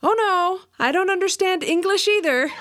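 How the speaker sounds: high, emphatic feigned helplessness; mock-innocent denial. A narrator's reading. Customer jokingly dodging the suitor's question, mock-innocent denial. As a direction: deny it with comic, high-pitched insistence.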